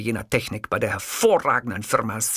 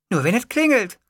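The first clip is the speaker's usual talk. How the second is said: high-pitched secretary voice; 10 semitones higher, 4 dB louder than usual. A narrator's reading. Secretary asking her boss about a ringtone, high character voice.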